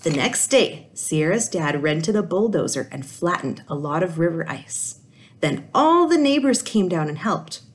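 put-on lively read-aloud voice for kids; animated children's story reading; storybook narration. A narrator's reading. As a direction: tell it like a picture book to a class. Teacher reads picture book aloud.

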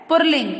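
"पुल्लिंग" said "pur-ling", r before l; wrong pronunciation. Shown as a demonstration. Teacher reading the wrong word, mispronounced. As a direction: put an r in "पुल्लिंग". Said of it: "पुल्लिंग नहीं बोलते".

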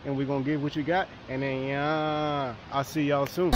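ordinary talk, nothing marked

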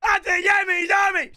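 loud high call of the name; calling out to get attention; shouting a name. Acted. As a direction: yell it across the room.

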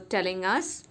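usual speaking voice

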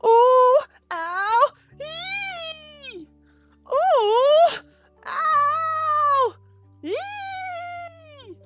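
very high-pitched squealed pain noises; theatrical yelps rising to a squeal; cried-out yelps. Acted. Mister McGee tries catching the pesky flea, squealed acted yelps. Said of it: "cried Mister McGee".

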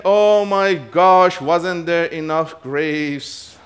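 drawn-out wail, starting high and loud, fading; hammed-up grumbling, every word stretched slow; mock complaining. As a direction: moan it out very slowly, sinking away. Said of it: "Complaining, murmuring, saying".

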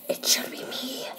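unvoiced secret whisper of me. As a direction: hush it like a secret. Whisper then normal repeat.

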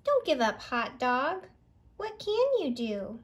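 ordinary delivery, nothing marked

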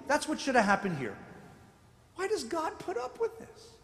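usual speaking voice

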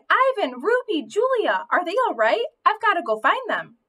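high-pitched, urgent worry for missing friends; worried calling out. Acted. Dog thinking of Ivan, Ruby and Julia, anxious and urgent. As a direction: fret aloud, voice jumping up high.

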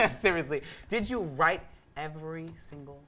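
ordinary delivery

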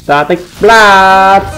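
slow, sustained call of the move's name; drawn-out skill call. Real, not acted. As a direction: stretch "Blast" out long and high. Hô tên chiêu trong game. (hô tên chiêu kéo dài)

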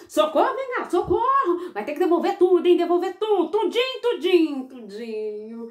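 high, loud gleeful orders; boy crowing at the innkeeper while the cane chases him; triumphant shouting. Acted.

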